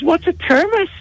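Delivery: very high, puzzled "A thermos?"; baffled question. Acted. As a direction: squeak it out, totally baffled. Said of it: "He says".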